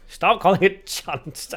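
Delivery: lightly hammed-up Glaswegian complaint; put-on Glaswegian. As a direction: drag it out in mock Glaswegian. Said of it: "I'm so Glaswegian".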